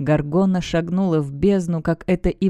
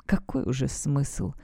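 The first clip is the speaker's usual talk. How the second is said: slow, much softer and lower, resigned; sad; 4 semitones lower, 8 dB quieter, slower than usual. A narrator's reading. Deus, rising from his knees, asks sadly and softly. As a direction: let the question sink in grief. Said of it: "грустно произнёс Деус".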